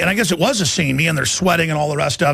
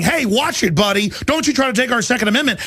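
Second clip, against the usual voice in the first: a warning, pitched up and rushed; a light mock threat to the buddy; higher, fast mock warning; 7 semitones higher than usual. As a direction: wag a finger at the buddy. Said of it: "I'm just saying".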